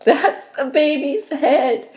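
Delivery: high, loud tearful yell. A narrator's reading. Grandmother yells seeing the baby's head, tearful. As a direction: cry it out through tears of joy. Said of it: "My mother yelled".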